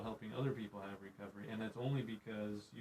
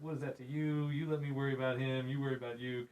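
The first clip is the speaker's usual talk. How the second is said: higher, louder mock scolding; firm rebuke; 4 semitones higher, 8 dB louder than usual. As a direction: brush the question off firmly. Jesus rebuking Peter, retold.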